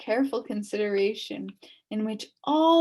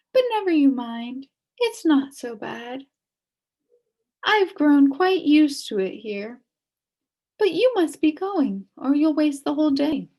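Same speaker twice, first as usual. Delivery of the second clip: higher, louder fond witch; kindly witch; 4 semitones higher, 5 dB louder than usual. A narrator's reading. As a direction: brush off your troubles kindly. Witch consoles Milo.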